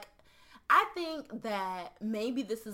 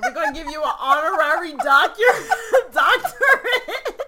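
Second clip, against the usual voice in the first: laughing; 5 semitones higher, 14 dB louder than usual. Real, not acted. Joking about an honorary doctorate.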